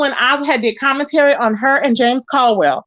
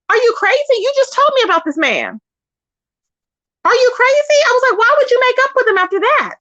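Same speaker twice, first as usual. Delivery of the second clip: incredulous outburst; 10 semitones higher than usual. Retold or imitated. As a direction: call her out in shock. Shocked at friend making up.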